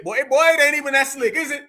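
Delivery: usual speaking voice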